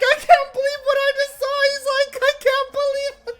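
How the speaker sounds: very high, loud disbelief voice; loud squeaky mock amazement; stunned rival. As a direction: squeal it in shocked falsetto. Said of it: "and he's like".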